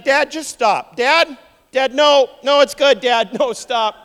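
loud, high comic pleading to stop; mock-desperate protest. Son begging Dad to stop the grim blessing, protesting. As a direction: cut in urgently, playing it up.

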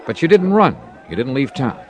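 normal talking voice

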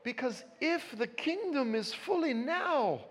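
put-on high, soft, slow old-lady voice; sweet little old woman. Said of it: "this little Scottish woman came up to me".

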